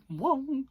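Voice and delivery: high, comic "whoa" effect; imagined growing sound. Pretend. Imagining the eye lump making a sound, high comic noise. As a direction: make a cartoon swelling noise. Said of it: "you can imagine if it did".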